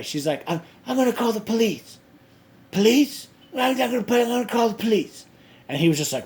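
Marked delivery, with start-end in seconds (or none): 0.5-5.3 high, loud imitation of an outraged woman; threatening. Woman threatening a man in the sauna, mock threatening. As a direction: mimic her threat in a shrill-pitched, raised voice. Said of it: "She starts threatening him".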